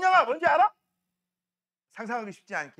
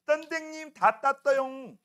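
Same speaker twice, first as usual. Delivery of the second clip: put-on high, drawn-out baby-talk call; little kid; 6 semitones higher, 3 dB louder, much slower than usual. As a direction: lisp it like a small child calling teacher. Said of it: "이런 애들 있어요". (혀 짧은 꼬마 흉내 낸 높은 목소리)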